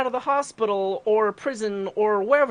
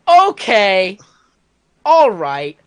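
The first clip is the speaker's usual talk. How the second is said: startled agreement; 10 dB louder, much slower than usual. Retold or imitated. Startled reply to threat.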